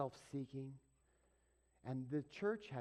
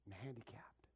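whisper; 4 semitones lower, 12 dB quieter than usual. Pretend. Voiced confession to toe.

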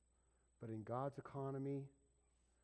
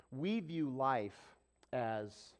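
slowed emphasis; 4 dB louder, much slower than usual. Real, not acted. Emphatic slow phrase.

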